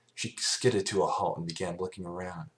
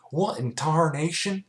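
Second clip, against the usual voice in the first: high, loud startled bellow; 10 semitones higher, 7 dB louder than usual. A narrator's reading. Applejack charging the creature, a loud bellow. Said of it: "she bellowed".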